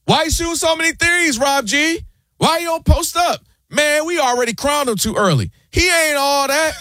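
mock barbershop critic shouting him down; mock fan heckling. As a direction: jeer like a loudmouth fan. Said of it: "What's the first thing they say?".

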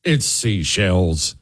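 slow, scoffing drawl of it's seashells; mocking dismissive. Host mocking commies' excuse, slow and scoffing. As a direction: sneer it out slowly like a brushed-off excuse. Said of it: "Oh, pff".

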